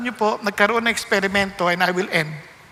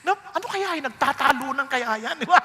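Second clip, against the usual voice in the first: usual speaking voice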